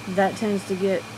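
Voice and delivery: usual speaking voice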